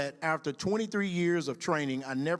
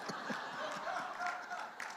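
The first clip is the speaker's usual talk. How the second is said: real laugh; 11 dB quieter than usual. Real, not acted. After punchline.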